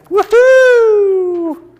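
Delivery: high, loud, drawn-out whoop; excited woohoo. Real, not acted. Teacher signing off to the group with a high whoop. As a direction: let out a long soaring cheer.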